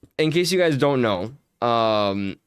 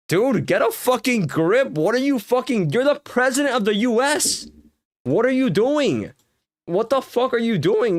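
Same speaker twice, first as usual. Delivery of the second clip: exasperated outburst; 7 semitones higher than usual. Real, not acted. Ranting angrily at the president.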